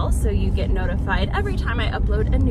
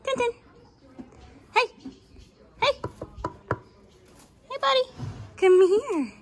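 high sing-song pet voice; talking to a dog; 12 semitones higher, 4 dB quieter than usual. Real, not acted. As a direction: coo sweetly at the animals. Baby talk to barn animals.

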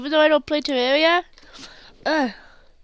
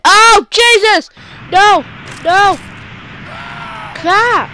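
loud high panicked yells; frantic yelling; 6 semitones higher, 6 dB louder than usual. Real, not acted. Gamer yelling through a frantic run. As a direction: shout in a rush of panic.

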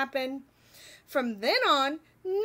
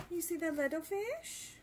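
soft aside; 10 dB quieter than usual. Real, not acted. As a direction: ask it softly. Aside to child listener.

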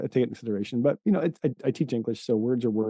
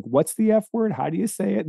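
put-on kid asking; 10 semitones higher than usual. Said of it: "occasionally it's like".

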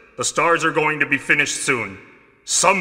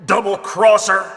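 angry accusation; 6 semitones higher, 4 dB louder than usual. Acted. Enrico snaps at Barry.

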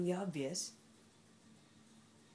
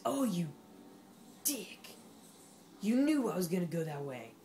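loud, raised-pitch scolding; annoyed outburst; 2 semitones higher, 5 dB louder than usual. Real, not acted. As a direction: call out the rival, loud and indignant. Scolds rival during the race.

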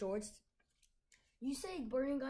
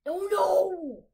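loud, high cry of dismay; real shout of alarm at the game; 11 semitones higher, 18 dB louder than usual. Real, not acted. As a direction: yell it as you get hit. Getting attacked in game.

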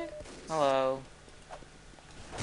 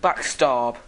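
"Backstab!" shouted louder with a falling finish; gleeful shout; 8 dB louder than usual. Real, not acted. Spy announcing his attack to the enemy, "Backstab!" shouted. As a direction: bark out "Backstab!" triumphantly.

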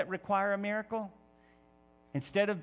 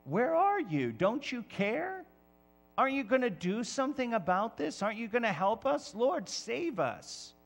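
frightened disciples pleading; 6 semitones higher than usual. Retold or imitated. Preacher voicing disciples questioning God in the storm, higher and pleading. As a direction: voice the scared disciples begging for help. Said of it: "they are struggling and questioning".

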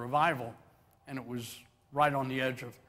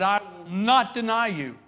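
loud, slow, high declaration; bold vow; 6 semitones higher, 9 dB louder, slower than usual. Acted. Peter vowing loyalty before the people, loud and proud. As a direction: swear it proudly before everyone. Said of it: "and said".

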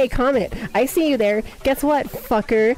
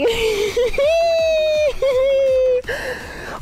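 long, high squealing giggle; giddy laugh; 9 semitones higher than usual. Real, not acted. Streamer greeting the chat after hiatus, giddy laugh. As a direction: break into a drawn-out, high giggle.

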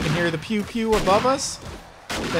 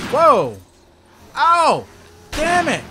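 sudden loud pained cry; startled yelp; 8 semitones higher, 6 dB louder, slower than usual. Real, not acted. Jump scare, hit by zombie.

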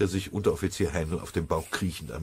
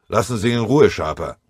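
loud, firm command; sharp order; 4 semitones higher, 8 dB louder than usual. A narrator's reading. Sergeant stopping the hothead from hitting the prisoner, sharp order. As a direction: stop him cold.

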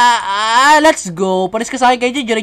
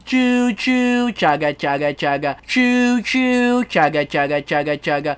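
train noises; 5 semitones lower, much slower than usual. Pretend. Kids pretend to be a train.

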